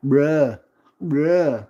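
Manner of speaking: mock teen bruh. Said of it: "people can look in on and be like".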